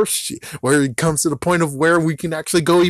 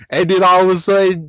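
ordinary delivery

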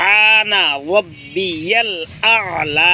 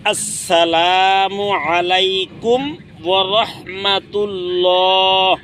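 prayer salam; 3 semitones higher, slower than usual. Shown as a demonstration. Teacher showing how to turn the head with the salam. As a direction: stretch the salam slowly like ending a prayer. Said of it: "আবার মুখটা এখানে আনতে হবে".

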